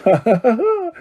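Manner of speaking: high gleeful cackle; poltergeist cackle. Acted. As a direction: cackle with mischief. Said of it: "Peeves cackled".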